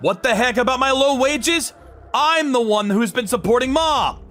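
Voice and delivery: indignant retort. Acted. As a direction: hit back at your brother. Indignant retort about wages.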